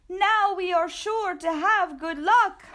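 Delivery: squeaky gleeful cheer. Acted. The perritos cheering over the burrito, high and gleeful. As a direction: promise good luck.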